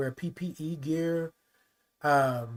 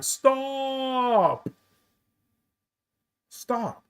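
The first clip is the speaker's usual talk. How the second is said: high, raised divine voice telling him to stop; voice of God; 6 semitones higher than usual. Retold or imitated. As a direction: command like a higher power, a touch lifted. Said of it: "this is how it was coming through".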